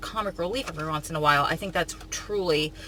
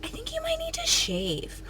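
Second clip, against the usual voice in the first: put-on high, sweet child voice; little girl voice. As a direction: sweetly imitate a little niece. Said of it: "She was doing it so sweet".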